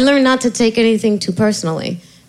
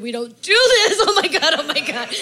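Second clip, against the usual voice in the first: laughing; 7 semitones higher than usual. Real, not acted. Laughing to the host about crying a whole hour. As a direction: giggle through every phrase. Laughing through therapy story.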